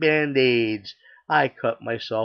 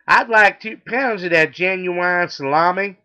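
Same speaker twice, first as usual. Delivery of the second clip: loud, high Texan twang; southern accent; 7 semitones higher, 7 dB louder than usual. Acted. Customer orders at the deli counter, loud southern accent. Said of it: "he's got a southern accent".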